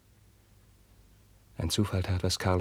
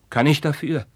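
higher and louder, pleading; desperate cry; 6 semitones higher, 7 dB louder than usual. Acted. Carlos defending himself to Roderich, desperate cry. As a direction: burst out in self-defence.

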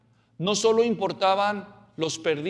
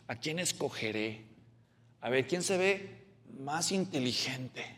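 casi en susurro, suave y bajo; susurro pensativo; 5 semitones lower, 12 dB quieter than usual. Retold or imitated. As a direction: murmura para sí mientras elige. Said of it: "entre todos los discípulos y decir". (Jesús susurrando para sí)

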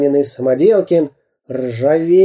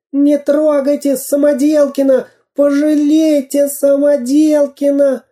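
much higher, desperate cry; pleading boy; 12 semitones higher than usual. A narrator's reading. Karandash runs to save his friend, desperate plea. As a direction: beg for your friend.

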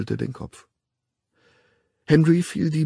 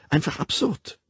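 absurd stressed and raised higher; 6 semitones higher than usual. A narrator's reading. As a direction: lean hard on absurd. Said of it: "betonte sie".

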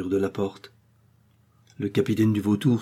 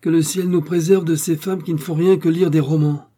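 higher and louder, sneering through a laugh; scornful mocking outburst, higher and louder; disdainful laugh; 8 semitones higher, 6 dB louder than usual. A narrator's reading. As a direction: laugh down at her with contempt. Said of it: "il dit en riant dédaigneusement".

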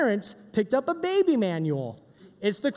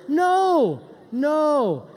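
emphatic no; 3 semitones higher, 4 dB louder than usual. Acted. Correcting the lesson.

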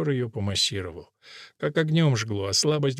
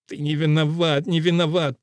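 raised, insistent groan of reassurance; moaning; 4 semitones higher, 4 dB louder than usual. A narrator's reading. Don Fermín absolves his companion of blame, moaning. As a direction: plead it as a groan, higher than usual. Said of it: "простонал Дон Фермин".

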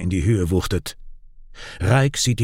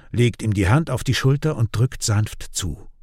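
usual speaking voice

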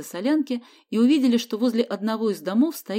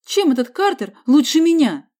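loud, high indignant protest; 7 semitones higher, 10 dB louder than usual. A narrator's reading. Boy protests rival is preferred, indignant. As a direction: demand it, voice shooting up.

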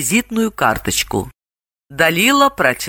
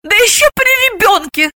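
very high, scandalised scolding; outraged, pitched way up; indignant; 3 dB louder than usual. A narrator's reading. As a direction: protest for the child's sake. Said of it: "возмутилась она".

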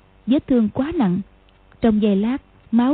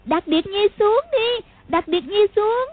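put-on high, slow child's plea to be put down; a young girl calling out, begging; kidnapped girl begging the stranger to let her down; little girl crying out; 10 semitones higher than usual. A narrator's reading. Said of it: "vội kêu lên".

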